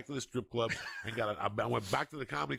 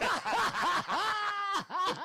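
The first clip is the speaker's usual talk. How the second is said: loud laugh; 5 dB louder than usual. Real, not acted. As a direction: burst of high, loud laughter. Laughs at drug smuggling story.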